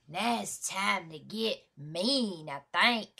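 slow, drawn-out menacing hype; slow build-up to something mean; getting mean. Real, not acted. Hyping up a meaner track.

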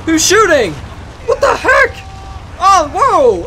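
high-pitched startled exclamations; startled alarm. Real, not acted. Player hears shooting, high startled alarm. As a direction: jump in pitch as if caught off guard. Gunfire breaks out in the game.

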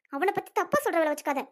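ordinary talk, nothing marked

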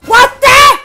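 high, loud burst of disbelief; startled yelp. Real, not acted. Player thrown by the game, startled yelp. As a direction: yelp it in shock.

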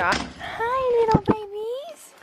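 very high, cooing dog voice. Real, not acted. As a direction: gush in a squeaky pet-owner coo. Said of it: "baby talk your dogs".

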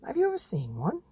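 high breathy mum voice; mum's voice. Acted. Mother explaining real animals, high airy voice. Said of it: "said Mum".

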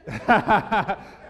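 a loud burst of laughter. Real, not acted. Pastor cracking up at his own made-up word.

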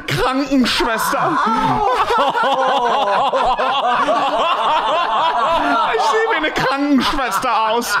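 high, laughing German voice; hammed-up German rant through laughter; mock German.

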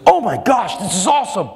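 high-pitched mock astonishment; amazed reaction. Astonished man to the stranger who read his life, excitedly imitated. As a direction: imitate someone blown away, pitch jumping up.

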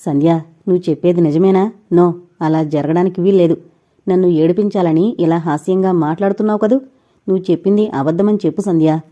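ordinary delivery

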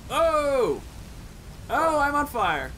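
sudden high alarmed cry; startled yelp. Real, not acted. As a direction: caught off guard by pain. Caught by a fire trap.

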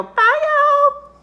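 put-on a cheery farm cook greeting the kids; slow, high drawling country lady; Missus Brown puppet. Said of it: "And bye, Missus Brown".

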